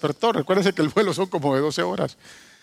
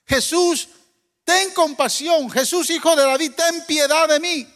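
clamor suplicante; 8 semitones higher than usual. Acted. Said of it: "usaba esa frase".

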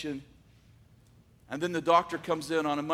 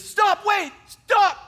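much higher, much louder cry to stop; desperate shout; 11 dB louder, much slower than usual. Retold or imitated. Johnny calling the nurse back from the doorway, a loud desperate shout. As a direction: call her back before she leaves.

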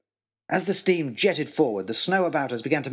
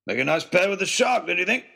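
joking Oxshott; 6 semitones higher than usual. Acted. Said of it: "he joked".

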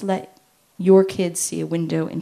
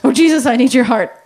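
loud heartfelt cry for help; sincere prayer; 5 semitones higher, 13 dB louder than usual. Retold or imitated. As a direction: call out to God sincerely. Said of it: "she went".

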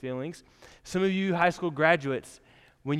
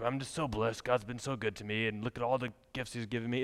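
mildly hammed-up holy-talk voice, a touch lower; put-on pious voice; 4 semitones lower, 6 dB quieter than usual. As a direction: play the humble-bragging churchgoer, lightly. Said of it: "I keep using that voice".

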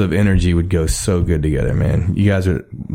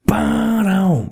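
high sung ba-dum; sung drum hit; 12 semitones higher than usual. Shown as a demonstration. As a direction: sing the drum fill. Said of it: "anthem drive, like a".

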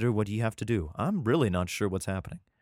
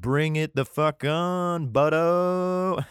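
higher, slow drawn-out challenge; taunting war cry; 5 semitones higher, 3 dB louder, much slower than usual. Acted. Micah pulling out an AK, hammed-up taunting war cry. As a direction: stretch the dare out, swelling at the end.

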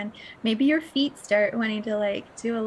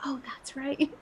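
hushed whisper of sudden remembering; whispered realization; 3 semitones higher, 6 dB quieter than usual. Retold or imitated. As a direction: whisper it as if it just dawned on you. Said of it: "spark get lit up inside of you again that's like".

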